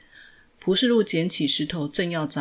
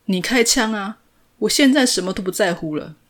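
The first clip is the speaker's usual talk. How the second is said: defiant shout; 4 semitones higher, 7 dB louder than usual. A narrator's reading. Defiant man dares the policeman.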